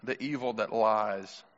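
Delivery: low, soft, spaced-out delivery; name each hidden struggle quietly, with long pauses; solemn sermon reflection. Acted. Preacher naming moms' private battles, low and solemn.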